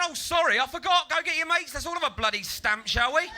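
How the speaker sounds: high, loud mock contrition; sarcastic apology. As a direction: rant a sarcastic invitation at full voice.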